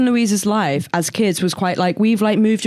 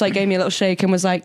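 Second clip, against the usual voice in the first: normal talking voice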